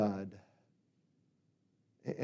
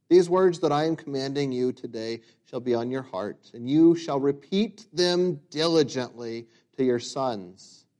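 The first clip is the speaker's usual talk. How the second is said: scripture reading; 4 semitones higher, 8 dB louder than usual. Acted. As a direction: proclaim the passage to the church. Reads scripture with force.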